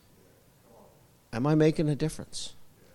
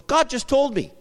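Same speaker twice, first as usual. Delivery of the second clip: bold declaration; 11 semitones higher, 5 dB louder than usual. Retold or imitated. Bold mother answers startled girl.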